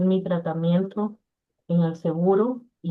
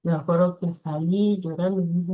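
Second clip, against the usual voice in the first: usual speaking voice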